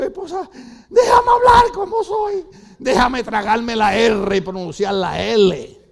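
high, drawn-out comic wail; mock-desperate plea. Husband begs wife to let him be, mock-desperate wail. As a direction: plead loudly, stretching the words.

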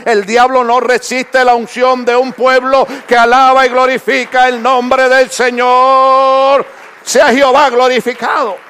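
preacher rousing the church; fervent preaching. Acted. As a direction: drive the praise home slowly. Preaching climax.

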